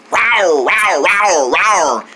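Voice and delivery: quick string of falling rawrs; tiger roars. Acted. Tiger fan imitating one, roaring. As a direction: fire off playful tiger roars.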